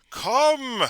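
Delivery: high, loud, very slow come-on call; carnival barker. Acted. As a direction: barker luring passers-by, drawn out.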